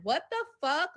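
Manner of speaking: high mock disbelief; shocked what the fuck. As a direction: react like you can't believe it. Retold shocked reaction.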